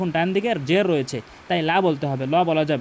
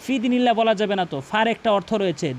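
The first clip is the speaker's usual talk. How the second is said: ordinary talk, nothing marked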